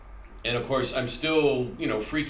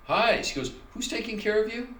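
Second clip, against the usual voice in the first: much higher, sing-song nurse voice; nurse's chirpy question; 11 semitones higher than usual. Retold or imitated. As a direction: play the oddly cheerful nurse asking. Nurse greets him in person.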